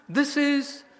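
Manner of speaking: slow, high, loud with feeling; emotional emphasis. Real, not acted. Teacher moved by his own teacher, emotional and emphatic. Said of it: "You see, I'm very emotional".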